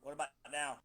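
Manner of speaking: faint, distant phone voice; sounding far off the microphone; far from mic. Real, not acted. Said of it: "you sound a little further away".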